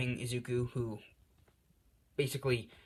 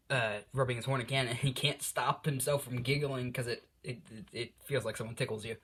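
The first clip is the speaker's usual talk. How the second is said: ordinary delivery, nothing marked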